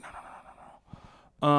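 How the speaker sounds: slow whispered no's; whispered dread. Retold or imitated. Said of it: "I was like".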